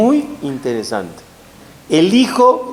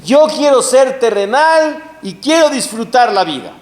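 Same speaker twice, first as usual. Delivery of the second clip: higher, louder, hungry for life; eager worldly boast; 8 semitones higher, 4 dB louder than usual. Retold or imitated. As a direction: declare it like a pleasure-seeker. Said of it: "Esav dice".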